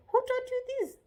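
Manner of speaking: very high, loud demand; accusing question. Shown as a demonstration. Parent confronting a mischievous child, accusing question. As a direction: pitch shoots up, demand an answer. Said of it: "you're not supposed to say".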